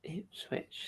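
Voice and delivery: hushed unvoiced echo of Ipswich; hushed incredulous Ipswich; disbelieving whisper. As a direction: breathe it back in disbelief.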